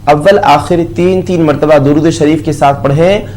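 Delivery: ordinary talk, nothing marked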